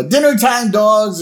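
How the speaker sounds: high, loud call to the pets; Marge. Retold or imitated. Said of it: "Marge comes out and he says".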